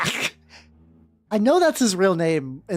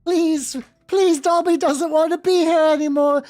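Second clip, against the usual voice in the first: high, whimpering house elf voice; house elf Dobby; 9 semitones higher than usual. Acted. Reading the fanfic aloud as Dobby, in a high pitiful voice. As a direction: squeak it out like a put-upon house elf.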